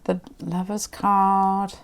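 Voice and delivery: slow, hushed naming of the card; slow card reveal. Real, not acted. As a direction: draw out The Lover's card softly. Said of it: "I'm talking to myself here".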